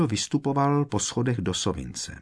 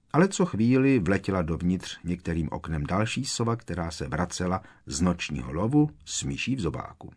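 ordinary delivery

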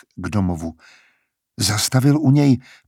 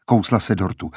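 ordinary delivery

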